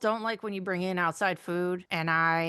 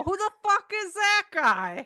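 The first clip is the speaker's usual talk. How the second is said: high, drawn-out mock yell; astonished outburst; 13 semitones higher, 4 dB louder, slower than usual. Retelling seeing a gorgeous man from the car, astonished outburst. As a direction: blurt it out in exaggerated amazement.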